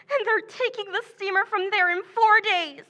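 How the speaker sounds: normal talking voice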